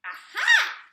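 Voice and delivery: very high, loud triumphant witch shout; gleeful witch eureka yell. Acted. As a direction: leap up with a shrieked aha. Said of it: "she shouted with a jump".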